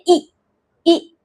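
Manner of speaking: ई said as a short, clipped 'ih'; short I sound. Shown as a demonstration. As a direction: demonstrate ई as the short vowel in kit. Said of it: "short sound आई की sound". (ई, छोटी sound)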